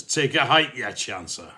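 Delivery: loud mock-gruff Glasgow dad, tailing off; comic outburst from a lassie's father; angry father. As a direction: send the suitor packing in mock Glaswegian. Voices the angry father at door.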